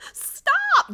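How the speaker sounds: high loud squeal; squealed stop. Real, not acted. Streamer begging chat to stop flirting, high loud squeal. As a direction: shriek it playfully.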